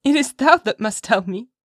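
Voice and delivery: high, loud playful insistence; capering child. A narrator's reading. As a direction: insist teasingly, as a little girl. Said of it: "laughing and capering about".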